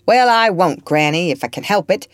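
cranky patient pushing back; irritable retort. Acted. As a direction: bark it back at granny, fed up. Grumbling sick man retorts.

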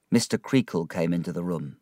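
usual speaking voice

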